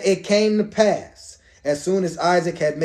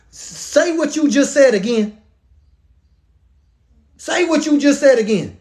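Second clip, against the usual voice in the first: incredulous demand; 6 semitones higher than usual. Acted. Isaac to the son claiming to be Esau, incredulous. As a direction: demand a repeat as if stunned.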